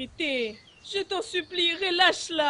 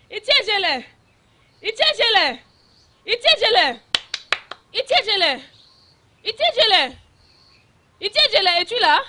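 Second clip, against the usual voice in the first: loud repeated calls of a name; desperate calling; 4 semitones higher, 9 dB louder than usual. Acted. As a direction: shout the name searching.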